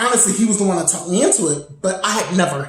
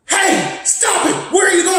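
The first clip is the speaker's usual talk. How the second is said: aggressive shout; 11 semitones higher than usual. Retold or imitated. Ex yelling at a stranger in the building, aggressive shout. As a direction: bark it like an angry ex catching someone. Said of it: "And he got real aggressive".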